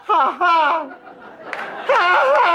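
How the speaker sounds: high, loud strangled gasps; pained gasping yelp. Acted. Kicked guy reeling in pain, high yelps. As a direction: wheeze out the pain in bursts. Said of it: "And the guy goes".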